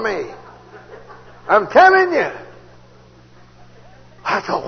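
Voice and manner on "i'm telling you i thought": ordinary talk, nothing marked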